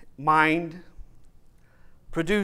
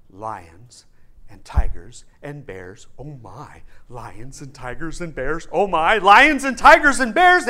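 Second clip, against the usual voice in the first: growing fear; 2 semitones lower, 8 dB louder than usual. Acted. Friends walk through the dark forest, fear mounting. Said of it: "they are expressing their deepest fear".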